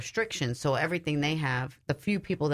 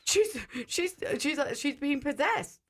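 much higher, stammering alarm; alarmed realization; 9 semitones higher than usual. Retold or imitated. Speaker realizing the woman is possessed, high stammering alarm. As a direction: panic breaking through. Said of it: "I'm like".

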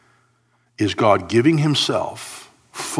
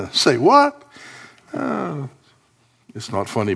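ordinary talk, nothing marked

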